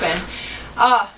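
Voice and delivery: ordinary talk, nothing marked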